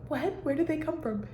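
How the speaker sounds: high, startled double take; retold surprise. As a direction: act out being caught off guard by the yellow leaves. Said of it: "and I'm like".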